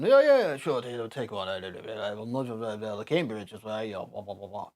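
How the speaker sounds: lightly put-on posh accent, blah-blah nonsense; posh upper-class imitation. Mocking British upper class to the stream chat in a posh English accent. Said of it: "speak all like this".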